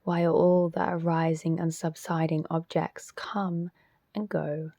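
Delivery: measured, spacious delivery, leaving silence after each instruction; guided meditation. Real, not acted. Teacher guiding listeners into self-inquiry, guided meditation voice.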